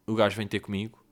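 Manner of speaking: usual speaking voice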